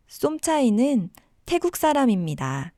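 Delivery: ordinary talk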